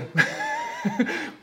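a sudden, very high laugh. Real, not acted. Reacts to the Frozen comment.

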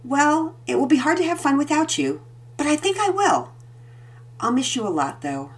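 a hint of a teasing mom; mother reassuring her son; Mama answering. A narrator's reading. Mama voiced, higher.

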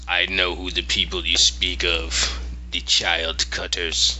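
lightly voiced low bear character; measured low delivery, a hint of the bear; Yorick. Bear answers girl about the child cutters, low and measured. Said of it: "And he goes".

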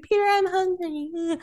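high-pitched hungry whine; whiny. Retold or imitated. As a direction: imitate a whiny, pleading complaint. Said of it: "she's still whining".

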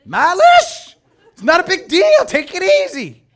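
high, loud, carefree dismissal; breezy brush-off. Acted. Friend short-changing you on a debt, breezy brush-off. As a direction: wave it away loudly as nothing. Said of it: "He gives you that and he says".

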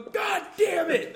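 high, loud, slow curse with a laugh; dad's curse. Retold or imitated. Dad opening the car, high loud slow curse. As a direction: drag out God damn it as the dad. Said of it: "My dad will open the car and go".

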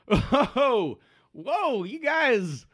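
high, loud, startled hail; worker calling out. Acted. Worker from next door interrupting a standoff, high alarmed call. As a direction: call over to break up a fight. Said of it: "you hear a voice from around the corner".

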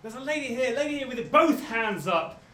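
usual speaking voice

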